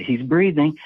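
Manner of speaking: quoted man's exclamation. Retold or imitated. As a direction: imitate a guy calling it out. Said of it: "You hear a guy say".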